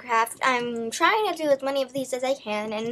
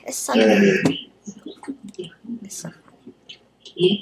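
low whispered mock voice; breathy whisper, playing it up; whispered funny voice; 5 semitones lower, 5 dB louder, slower than usual. Repeats line in odd voice.

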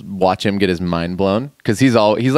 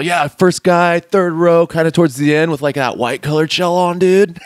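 higher, louder surfer call-out; surfer turtle voice; 8 semitones higher, 3 dB louder than usual. Retold or imitated. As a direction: point someone out like a surfer host. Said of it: "he's all".

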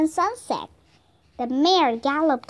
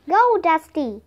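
loud, raised command; urgent yell, higher and louder; shouted; 4 semitones higher, 6 dB louder than usual. A narrator's reading. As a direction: shout to spur the horse away. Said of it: "he shouted".

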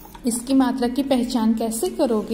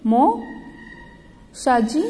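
"मौसा जी" drawn out very slowly; slow sounded-out word; much slower than usual. Shown as a demonstration. Teacher reading "मौसा जी" in the story to children, slowly stretched. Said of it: "Jaunpur से गौरी के मौसा जी".

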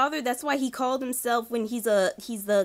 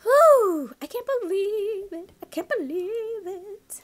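relieved disbelief; 8 semitones higher, slower than usual. Real, not acted. Relief after escape.

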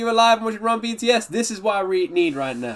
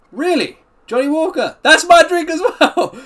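higher, louder and slow, delighted to share the same drink; thrilled; 10 semitones higher, 6 dB louder, slower than usual. Real, not acted. Streamer thrilled a star drinks her whiskey, excited and loud. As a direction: gush that it's your drink too, slowly. Fan learns star's favorite whiskey.

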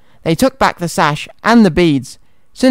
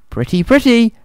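high, admiring little mouse; lightly delighted high exclamation; exclaiming; 6 semitones higher than usual. A narrator's reading. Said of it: "Gus exclaimed".

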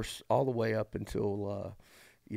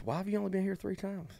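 put-on old lady voice; 10 semitones higher, faster than usual. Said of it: "she goes".

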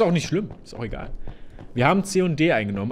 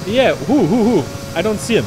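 gym bro; 6 semitones higher, 6 dB louder than usual. Pretend. Bro voice in firefight.

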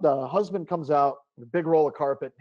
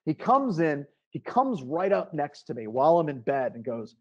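usual speaking voice

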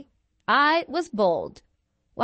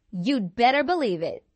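ordinary delivery, nothing marked

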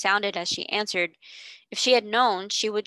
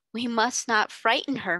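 ordinary delivery, nothing marked

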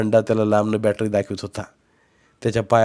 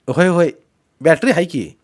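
raised, higher reply; calling back; 6 semitones higher, 5 dB louder than usual. A narrator's reading. Boy saying he has a torch, calling back. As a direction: loudly brush off the caution. Nephew answers across stream.

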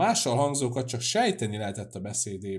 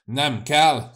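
loud, raised angry refusal; shouted refusal; 4 semitones higher, 10 dB louder than usual. A narrator's reading. Cecei jumps up to refuse the house, shouted refusal. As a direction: shout it down flat out. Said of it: "kiáltott Cecei fölkelve".

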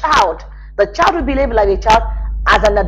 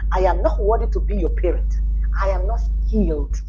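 mock confession; 4 semitones lower, 4 dB quieter than usual. Voicing a parent's confession to child.

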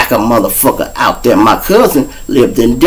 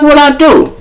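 protesting; 14 semitones higher than usual. Retold or imitated. Protesting arrest.

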